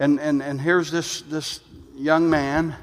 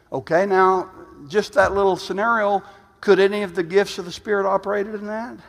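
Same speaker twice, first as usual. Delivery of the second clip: usual speaking voice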